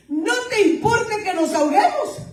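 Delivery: high desperate cry; panicked plea. Acted. Disciples cry out to sleeping Jesus, desperate and high. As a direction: plead as if the boat is going down.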